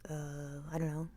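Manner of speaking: put-on low, quiet, noncommittal kid; reluctant kid. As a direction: imitate a kid answering reluctantly, low and soft. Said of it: "And the kid's like".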